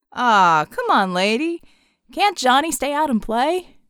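loud, pleading call. Acted. Red calling across the bar to Savior, loud playful plea. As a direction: holler after her playfully.

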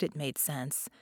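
ordinary delivery, nothing marked

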